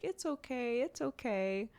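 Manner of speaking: put-on high, soft little-kid voice; toddler comforting mom. Mom imitating her toddler comforting her, high soft child voice. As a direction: gently imitate a small child soothing mommy.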